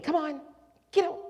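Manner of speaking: high, prodding mother voice; high coaxing mom voice; mother bird nudging. Retold or imitated. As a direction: chivvy the chick out of the nest, pitched high. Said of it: "and mom's saying".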